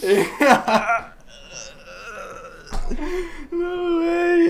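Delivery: high laughing disbelief. Real, not acted. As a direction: squeal through laughter.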